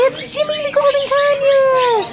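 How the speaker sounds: high pitched teaching voice; high sing-song for bird. Shown as a demonstration.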